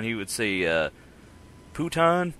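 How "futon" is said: "Puton" said with a p in place of the f; dad's mispronunciation. Retold or imitated. Imitating dad getting the word futon wrong, "Puton". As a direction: mimic dad saying "Puton" with a p. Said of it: "he couldn't say futon".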